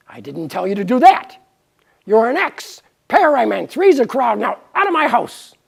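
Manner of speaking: loud, fast scolding; angry God. Acted. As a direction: throw them out of the house. God throws them out.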